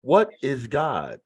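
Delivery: What is God drawn out slowly; slow dramatic question. Shown as a demonstration. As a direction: let "What is God" hang, slow and heavy. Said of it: "repeats the topic question slowly".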